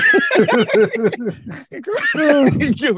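a high, long burst of laughter; high cackling laugh. Real, not acted. Retelling the funny scene: a long, high laugh.

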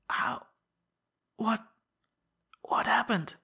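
faltering, breathy hoarse whisper. Acted. Frightened old minister whispering to Laroque for news. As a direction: rasp out the question in a whisper. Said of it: "he whispered hoarsely".

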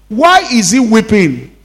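ordinary delivery

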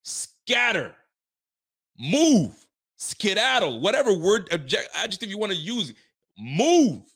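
high, loud one-word commands; barked orders. Real, not acted. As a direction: shout them off the ball like a frustrated coach. Ranting orders at players.

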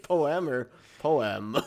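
"poem" tried twice as a high, loud po-em; Mister Simmons's pronunciation. Retold or imitated. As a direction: test out "poem" in two exaggerated syllables, po-em.

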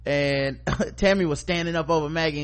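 ordinary talk

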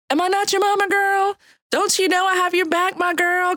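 sassy Cajun mama. Acted. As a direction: play a Cajun mother who's got your back. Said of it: "in my own Cajun translation".

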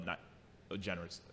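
usual speaking voice

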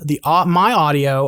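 ordinary delivery

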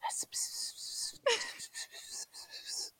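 very soft whispered nonsense; imitation of secretive whispering; mock whispering. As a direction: pretend to whisper "sp-sp" right into an ear. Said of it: "That's what he's whispering".

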